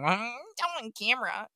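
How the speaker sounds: high put-on smug driver voice; mock driver voice. Co-host imagines the driver caught committing a crime, in a high mocking voice. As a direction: say it like a smug fool. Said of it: "coming up with fake voices for him".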